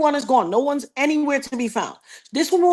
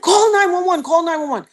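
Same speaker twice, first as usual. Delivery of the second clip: higher, louder urgent order; urgent command; 7 semitones higher, 3 dB louder than usual. Retold or imitated. Telling the wife to call for help, urgent. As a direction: bark it at her twice.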